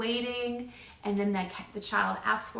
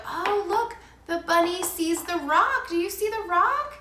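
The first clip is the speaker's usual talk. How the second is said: parallel talk; 8 semitones higher, 4 dB louder than usual. Shown as a demonstration. Parent narrating the child's play, high excited sing-song. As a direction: narrate the dog's walk with wonder. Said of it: "you go".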